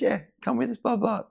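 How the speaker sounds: high, squeaky imitation of the old surfer; mock old guy voice. Older surfer inviting the learner out on a windy day, high mock voice. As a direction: toss off the invite in a silly high voice. Mick inviting him along.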